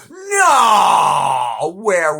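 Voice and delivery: loud mock-outraged shriek; shocked shout. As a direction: explode in mock disbelief.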